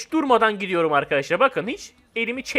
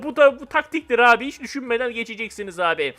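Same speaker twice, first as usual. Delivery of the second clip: ordinary delivery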